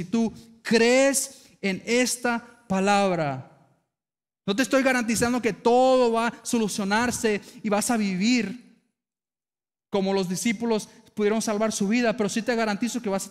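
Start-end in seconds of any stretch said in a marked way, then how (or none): none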